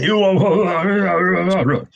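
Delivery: sudden high sharp yell; mock yelling. Elder imitating a yeller to teach gentleness, mock yell. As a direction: bark it like an angry man at people. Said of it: "yell and scream and say".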